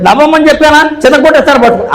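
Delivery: high, scolding husband's voice; a hint of a nagging husband; mock husband scolding. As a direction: play the bossy husband demanding a smile. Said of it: "అనుకోరు".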